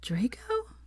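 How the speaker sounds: drowsy, breathy whispered question; just woken, whisper his name; sleepy whisper. A narrator's reading. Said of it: "a sleep-riddled voice".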